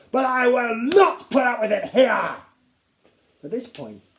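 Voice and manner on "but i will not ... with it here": high, loud roar; furious declaration. A narrator's reading. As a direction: slam down the rule. Captain lectures.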